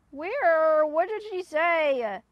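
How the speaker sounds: loud, high, drawn-out wail. Real, not acted. Streamer hunting for a missed chat message, loud wail. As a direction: stretch it out slowly and loudly.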